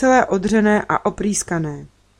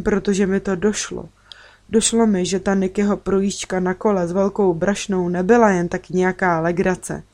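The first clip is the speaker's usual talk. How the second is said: usual speaking voice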